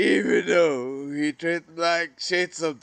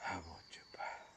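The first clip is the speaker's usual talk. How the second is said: breathless whisper, much softer; whispered wish; 8 semitones lower, 19 dB quieter, faster than usual. Real, not acted. Missing his brother, he whispers the wish to himself. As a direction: barely voice it, just breath.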